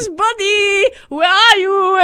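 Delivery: playful laughing call for a hidden potato friend. Acted. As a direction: giggle through the call. Olma laughing, calling for potato.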